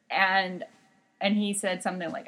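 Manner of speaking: ordinary talk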